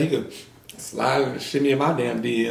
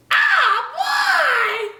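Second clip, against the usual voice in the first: very high squealing laugh burst; shrieking laugh. Real, not acted. Startled by something, she lets out a high squealing laugh. Sudden burst mid-talk.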